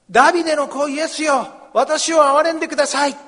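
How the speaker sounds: loud, high desperate plea; crying out. Acted. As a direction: cry out to be heard over the crowd. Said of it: "大声で叫んだんです".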